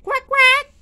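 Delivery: very high, loud quacking; duck quack. Acted. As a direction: squawk it like a duck. Duck sound in refrain.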